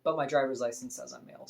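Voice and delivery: usual speaking voice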